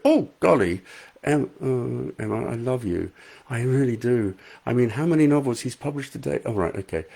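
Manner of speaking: ordinary talk, nothing marked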